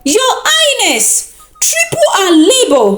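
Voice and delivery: bewitched men shouting with a laugh; crowd shouting. A narrator's reading. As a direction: cry it out to the king, half laughing. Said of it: "But the men shouted".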